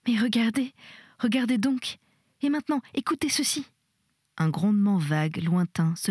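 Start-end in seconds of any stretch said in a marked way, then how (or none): 0.1-3.7 insistent plea climbing higher; panicky urging. A narrator's reading. Terrified patient begs the nurse to look and listen, urgent. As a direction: urge her on, voice rising with alarm.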